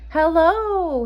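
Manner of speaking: high, loud call of hello. A narrator's reading. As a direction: call out across the empty forest. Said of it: "he cried".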